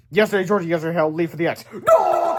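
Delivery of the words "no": very high, louder cry of despair; cried. Acted. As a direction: shout no in horror. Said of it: "cried yesterday, Harold".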